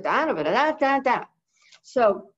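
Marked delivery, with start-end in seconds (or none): 0.0-1.2 light run of da syllables; mock garbled speech. Owner showing how her fast talk sounds to the parrot, mild babble. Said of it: "I sounded like this".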